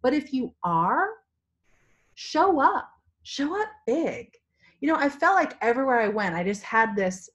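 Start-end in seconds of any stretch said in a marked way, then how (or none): 2.1-4.3 high, punched SHOW UP, then a drawn-out BIG; emphatic urging. Real, not acted. Seller challenging viewers to get out there, emphatic urging.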